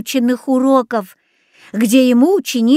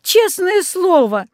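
high, slow, imploring plea; a boy begging his father to believe him, high and slow; pleading; 9 semitones higher, slower than usual. A narrator's reading. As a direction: beg him to believe you, drawn out slowly. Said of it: "взмолился я".

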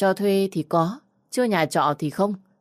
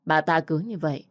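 ordinary delivery, nothing marked

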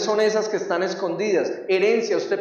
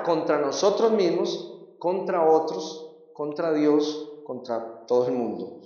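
slowing and fading; 3 dB quieter, slower than usual. Acted. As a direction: let the list die away slowly. Enumeración pausada que se apaga. (cada vez más lento y más bajo)

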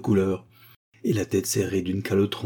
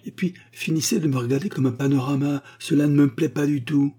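higher, louder annoyed mummy; scolding mummy; 7 semitones higher, 4 dB louder than usual. Acted. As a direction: tell him off for staring.

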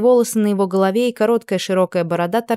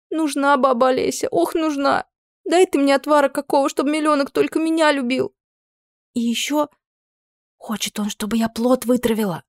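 high, desperate pleading; a teen girl begging for a potion; 8 semitones higher than usual. Acted. Desperate teen girl begging the witch for a love potion. Girl's voice.

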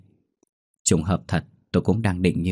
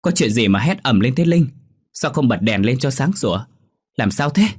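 high, louder alarmed questions; panicked; 6 semitones higher, 4 dB louder than usual. A narrator's reading. Mother-in-law hears screaming upstairs, panicked. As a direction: burst in with anxious questions. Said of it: "Mẹ chồng tôi hoảng hốt".

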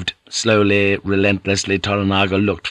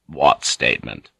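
much lower lord's voice; curt and forbidding; 9 semitones lower than usual. A narrator's reading. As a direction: demand it coldly, low. Lord curtly answers his heir.

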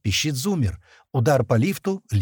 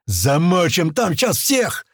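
high, slow battle cry; yelling back; 4 semitones higher, 4 dB louder, slower than usual. A narrator's reading. A worker answers the rallying call, yelling. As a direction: yell it from the crowd. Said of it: "орёт кто-то в ответ".

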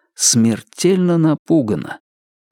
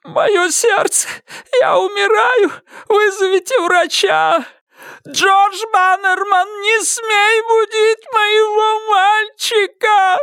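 hysterical shrieking; slower than usual. Acted. Shrieking during heart attack.

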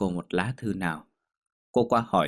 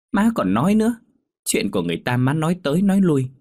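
high, raised, rapid telling-off; fed-up daughter's high nagging; exasperated scolding; 9 semitones higher, 4 dB louder, faster than usual. Acted. Daughter scolds gossiping mother.

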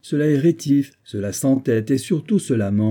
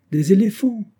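high, louder excited retort; eager retort; 11 semitones higher, 4 dB louder than usual. A narrator's reading. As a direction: fire it back with glee. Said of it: "riposta le capitaine Hod".

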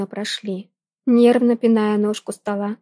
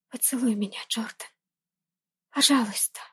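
breathy whispered plea; soft moaning plea; 8 dB quieter than usual. Acted. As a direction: whisper it against his lips. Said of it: "Тихо простонала в его губы".

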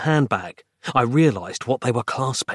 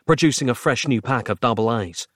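ordinary delivery

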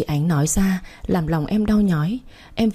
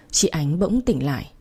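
plain reading voice

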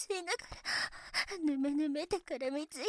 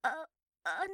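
ordinary delivery

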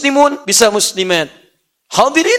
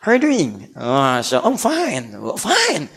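put-on English accent; 5 semitones lower, slower than usual. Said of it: "kayak orang Inggris".

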